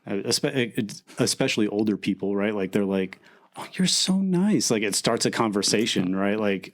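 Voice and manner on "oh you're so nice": higher, slow, lightly voiced elderly lady; sweet old person. Older shopper thanking a polite stranger, higher and slow. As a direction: coo it gently like a grandma.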